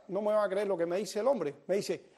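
ordinary delivery

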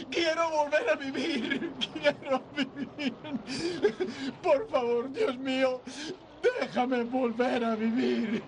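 choked, sobbing plea to be let live; pleading to God, voice cracking with crying; tearful prayer. Acted. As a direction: beg through tears, breaking. George begs God, sobbing.

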